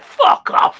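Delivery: loud burst of refusal; retold outburst. Lad told to go across, loud retold refusal. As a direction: throw it out loudly, acting the refusal.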